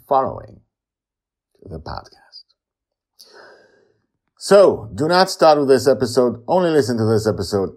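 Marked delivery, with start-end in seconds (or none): 4.4-7.8 loud, high-pitched warning to listeners; emphatic warning. Real, not acted. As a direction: warn them off firmly and loudly.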